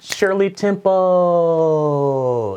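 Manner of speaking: loud, high, very slow call. Retold or imitated. Waiter presenting the drink to [name], loud and drawn out. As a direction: belt it out slow like a proud waiter. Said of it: "I'm pretty sure I went".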